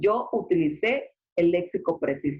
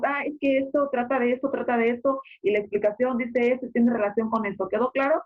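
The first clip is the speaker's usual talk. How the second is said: higher, fast run-on presenter; rushed, no pauses; 5 semitones higher, faster than usual. Shown as a demonstration. Teacher mimicking a presenter who leaves no pauses, rushed and higher-pitched. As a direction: rattle the explanation off without a breath between ideas. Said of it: "no debemos de ir muy rápido".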